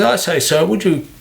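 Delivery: lightly hammed-up toff offering a favour; posh gent voice. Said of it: "Because that's how they talk".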